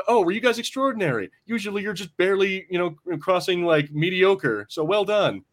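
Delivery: high-pitched mock condescending praise; lightly acted backhanded compliment; mean condescension. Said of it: "she's so mean".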